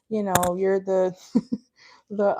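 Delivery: ordinary delivery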